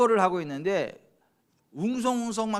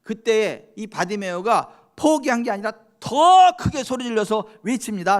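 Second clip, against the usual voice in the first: ordinary talk, nothing marked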